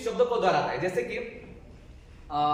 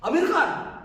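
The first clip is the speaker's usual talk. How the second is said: Aamir खान pitched much higher and louder; emphatic questioning echo; 8 semitones higher, 4 dB louder, slower than usual. Shown as a demonstration. Teacher demonstrating parroting the friend's words, Aamir खान raised high. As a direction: echo Aamir खान back with surprised interest. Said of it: "फिर वापस क्या करना है".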